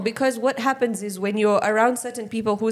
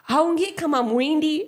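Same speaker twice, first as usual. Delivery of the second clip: high, slow mock Swahili remark; imitating amazed onlookers; 7 semitones higher, slower than usual. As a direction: draw it out high, as a surprised stranger. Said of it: "So they go like".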